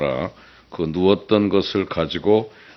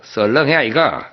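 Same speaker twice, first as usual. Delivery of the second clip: blunt Gyeongsang shout; 6 semitones higher, 5 dB louder than usual. Acted. Gyeongsang husband's blunt answer.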